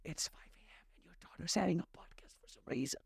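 soft whispered machine reply; whispering Alexa. Retold or imitated. Alexa whispering the time back to dad. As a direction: whisper back like a smart speaker. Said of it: "she's gonna reply".

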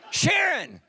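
high, loud cry of her name; exclaiming in mock dismay. Preacher reacting to Sharon's fear of shooting, exclaiming. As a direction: blurt the name high, falling away.

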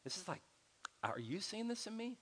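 soft, tender ask, voice rising; gentle question to spouse. Retold or imitated. Husband asking his wife about himself, soft and tender. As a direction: ask your partner gently. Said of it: "Sit down and go".

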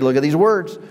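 ordinary delivery

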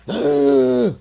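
sharp high rasping gasp; a sudden startled undead gasp; zombie gasp. Retold or imitated. Dad imitating the drowned woman rolling over, sharp gasp. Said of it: "looked at her and went".

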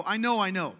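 loud, fed-up "I know, I know"; impatient brush-off. Retold or imitated. Mock church member brushing off a brother's advice, loud and impatient. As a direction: cut the helper off loudly. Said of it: "you don't like to be taught".

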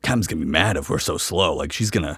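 low, soft nervous muttering; worried crew muttering. Retold or imitated. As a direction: mutter it anxiously under breath. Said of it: "And they're like".

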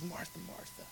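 very soft, tender calling of a name. Acted. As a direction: say the name twice, gently hushed. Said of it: "it's a tenderness in his voice".

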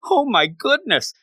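high-pitched, acted-out burst of excitement; excited realization. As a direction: imitate a thrilled realization. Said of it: "then he gets so excited".

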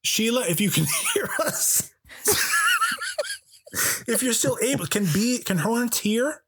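high, laughing through the words. Real, not acted. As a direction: crack up mid-sentence. Laughing while addressing Sheila.

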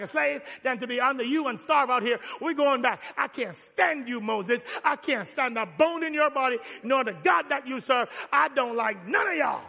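rebellious Israelites yelling at Moses; angry complaint. Acted. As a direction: throw the complaint in his face.

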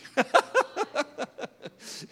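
a high, delighted burst of laughter; joyful laugh. Real, not acted. Preacher laughs to the congregation, high and delighted. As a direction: laugh out loud with joy. Laughs after "God loves me.

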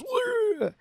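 high, held mock wail; mock pained cry. Villain hit by the car door, high mock cry. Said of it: "And he goes".

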